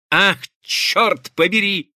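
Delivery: slow, high-pitched exclaimed curse; exclaiming. A narrator's reading. Husband, now truly frightened at the door, exclaiming. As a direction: exclaim it in alarm, drawing it out. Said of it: "воскликнул Каупервуд".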